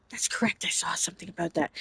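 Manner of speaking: unvoiced whisper. Real, not acted. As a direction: breathe it out as a whisper. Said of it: "whispered aside reading chat".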